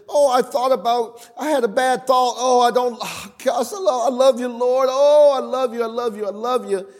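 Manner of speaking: a hammed-up, breathless worshipper straining to love God; overdone pleading devotion; mock gushing believer. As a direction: play the frantic believer trying too hard. Mock over-eager devotion of believers.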